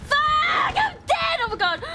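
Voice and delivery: high, loud panicked yell. Real, not acted. Player killed in the game, yelling. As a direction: shriek it out in real alarm. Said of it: "I'm so sorry for yelling".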